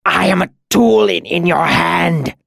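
high, strained gasp of pain; agonized cry. A narrator's reading. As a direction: cry out like a wounded animal. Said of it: "he convulsed and cried out".

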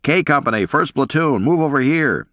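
high-pitched officer's call, moderate volume; shouted order. A narrator's reading. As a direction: call the men over from a distance. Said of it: "yelled and shouted orders".

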